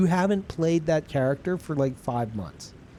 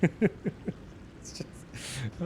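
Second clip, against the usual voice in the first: whispering; 8 dB quieter, much slower than usual. Real, not acted. Unvoiced aside.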